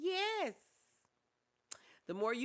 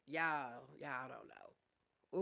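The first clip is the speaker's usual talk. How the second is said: close to tears; 4 semitones lower, much slower than usual. Real, not acted. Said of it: "I'm about to tear up".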